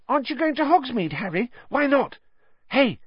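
put-on a thrilled kid calling out to his hero; eager Colin. A narrator's reading. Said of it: "Colin looked eagerly".

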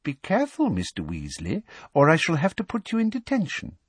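put-on high, falsely sweet girlish threat; sugary warning in a high voice; Umbridge. A narrator's reading. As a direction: warn sweetly, pitched high. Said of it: "said Umbridge".

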